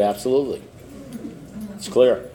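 low, soft, unruffled sponsor; calm certainty. Retold or imitated. Sponsor assuring upset sponsee he knows the reason, quietly certain. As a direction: state it softly as settled fact.

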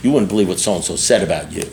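low, confiding gossip voice; lightly acted tattletale, low in pitch; gossiping false friend. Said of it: "people who say".

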